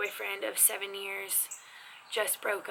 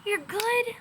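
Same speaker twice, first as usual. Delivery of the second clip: bright reply; 13 semitones higher, 4 dB louder, slower than usual. Shown as a demonstration. Cheerful answer in a demo.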